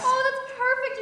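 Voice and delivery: high, loud gush of delight; delighted congratulations. Acted. Friend congratulates the new bride, delighted. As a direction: gush loudly over the good news.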